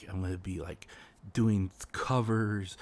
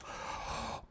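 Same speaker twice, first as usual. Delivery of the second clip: hushed mock gasp; 15 dB quieter than usual. Scandalized by the taboo.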